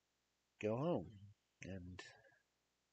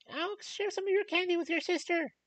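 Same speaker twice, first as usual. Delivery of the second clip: high, loud motherly shout at her son; mom shouting; 11 dB louder than usual. Retold or imitated. Mother shouting at her son to share candy. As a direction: yell it down at a greedy kid. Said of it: "my mom just kinda shouts".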